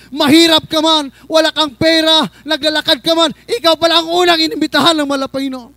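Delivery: loud chanted list rising to a high shout; building sermon climax. Real, not acted. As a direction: build phrase by phrase to full pulpit cry. (papalakas at pasigaw na pangangaral)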